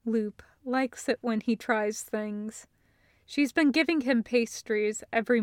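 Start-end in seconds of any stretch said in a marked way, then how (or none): none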